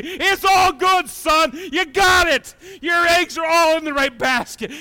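high, loud reassuring shout; reassuring God. Acted. God assuring the psalmist his eggs are safe, loud. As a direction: convince him it's all good. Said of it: "He's just convinced me".

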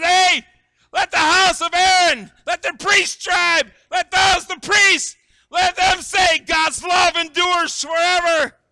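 full-throated, high, drawn-out shout; wildly amped psalmist yelling; amped calling out. Acted. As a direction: holler the summons to a crowd.

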